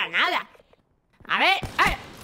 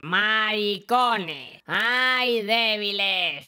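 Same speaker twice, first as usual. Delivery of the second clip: drawled taunt; 8 semitones lower, much slower than usual. Acted. Catchphrase taunt in game.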